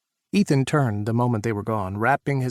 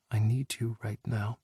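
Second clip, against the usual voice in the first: whispered; 5 dB quieter than usual. A narrator's reading. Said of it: "Jack whispered".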